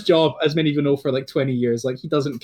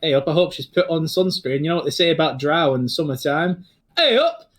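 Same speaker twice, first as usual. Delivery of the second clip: Northern English comic voice. Acted. Commentator Larry joking to the arriving students, Northern English voice. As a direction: crack wise like a Northern English announcer. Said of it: "And he goes".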